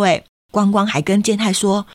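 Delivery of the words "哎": ordinary delivery, nothing marked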